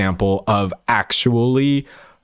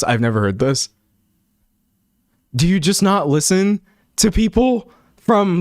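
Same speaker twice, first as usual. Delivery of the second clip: ordinary talk, nothing marked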